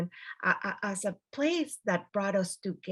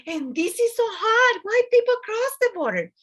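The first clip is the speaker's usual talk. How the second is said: shocked exclamation; 14 semitones higher, 7 dB louder than usual. Retold or imitated. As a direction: exclaim it in shock, much higher. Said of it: "This is like".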